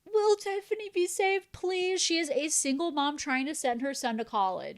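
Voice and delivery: high-pitched, hammed-up worried plea; mock pleading. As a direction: beg theatrically for someone else's sake. Acts out pleading for Tiffany.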